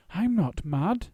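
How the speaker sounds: high, slow indignant protest; the little bear takes offence. A narrator's reading. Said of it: "said Paddington indignantly".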